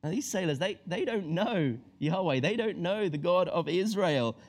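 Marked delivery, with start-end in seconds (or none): none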